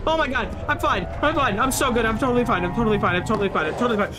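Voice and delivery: loud, rapid-fire insistence in a panic; panicked self-reassurance. Real, not acted. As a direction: blurt it over and over, frantic. Panicked self-reassurance after scare.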